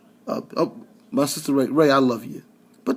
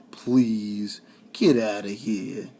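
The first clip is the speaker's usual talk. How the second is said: dismissive; 5 semitones lower, much slower than usual. Real, not acted. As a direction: wave it away with a sigh. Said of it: "dismissing callers' claim".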